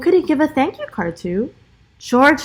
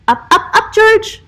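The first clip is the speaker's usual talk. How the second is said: higher and louder with each up; cheering climb; 11 semitones higher, 5 dB louder than usual. Real, not acted. Narrator urging George up the streetlight, excited. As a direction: egg George on up the pole.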